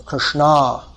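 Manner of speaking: Krishna with neutral first vowel and long final a; proper Krishna. Shown as a demonstration. Teacher insisting on pronunciation, Krishna with long open ending. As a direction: draw out the last a of Krishna, falling. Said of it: "I'm talking about pronunciation here".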